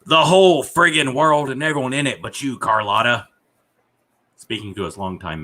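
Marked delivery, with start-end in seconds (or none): 0.1-3.3 loud, high, theatrical outburst; hammed-up booming farewell; acted loud declaration. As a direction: proclaim it like grand last words. Acting out defiant last words.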